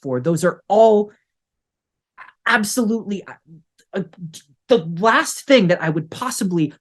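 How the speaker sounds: irritated scolding. Acted. Irritated doctor scolding staff.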